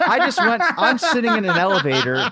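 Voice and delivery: high, loud words broken by laughter; laughing. Real, not acted. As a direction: laugh your way through the line. Laughing at his own story.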